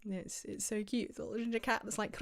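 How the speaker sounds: ordinary talk, nothing marked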